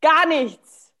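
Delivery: harsh German inner critic; stern rule-making voice. Acted. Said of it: "kind of this Nazi, like, rule-making voice".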